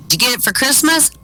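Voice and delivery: put-on high-pitched playground kid; curious boy. Alex asks his friend about the watch, curious boy. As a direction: pipe it up like a little boy. A child voiced in a joke.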